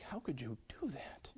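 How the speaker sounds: very soft whispered reproach; imitated hushed, wounded disbelief; hurt child's whisper. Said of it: "he was looking at me like".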